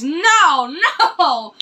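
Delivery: ordinary talk, nothing marked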